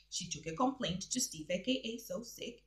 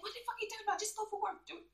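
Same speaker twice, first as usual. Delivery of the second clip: high, softer pretend retort; mock exasperated boss; 13 semitones higher, 5 dB quieter, much faster than usual. Presenter voicing Steve dismissing her complaint, mock exasperation. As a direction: brush them off in a high put-on voice. Dubs Steve's reply on silent video.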